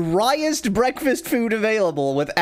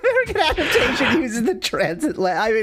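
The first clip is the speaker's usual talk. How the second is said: laughing; 7 semitones higher than usual. Real, not acted. Laughing through the remark.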